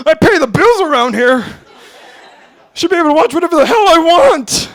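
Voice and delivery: put-on high, loud kid aping his dad's lecture; a little boy parroting his father's tirade; kid imitating dad. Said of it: "remembering things I heard my dad say".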